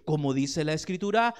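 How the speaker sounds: ordinary delivery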